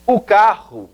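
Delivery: "o carro" said as "u kahu"; Portuguese o carro with a guttural r; "o carro", Portuguese style. Shown as a demonstration. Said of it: "o el carro".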